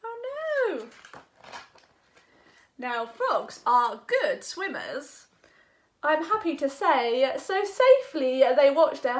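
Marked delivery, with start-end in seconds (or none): none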